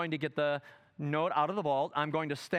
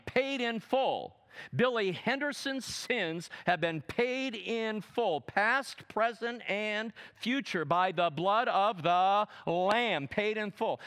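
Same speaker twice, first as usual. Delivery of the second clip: higher, slow, declaiming; preaching at full force; 5 semitones higher, slower than usual. Acted. Preacher to congregation, slow climactic declaration. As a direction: hammer home that the debt is paid.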